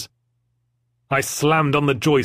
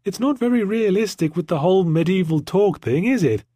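put-on little girl voice; 7 semitones higher than usual. A narrator's reading. Little girl Jemima commenting.

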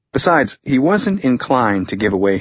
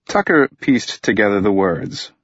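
plain reading voice

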